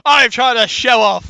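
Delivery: high, loud exasperated protest. Real, not acted. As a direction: protest at having your boast spoiled. Boast spoiled by friend's pun.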